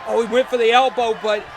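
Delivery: high, loud shouted call; excited shout. Real, not acted. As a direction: yell the action as it happens. Live wrestling play-by-play.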